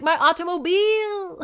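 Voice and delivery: high, loud mock wail with a long-drawn last syllable; Cloris Leachman. Woman mourning her car in a frozen lake, hammed-up wail. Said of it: "Cloris Leachman".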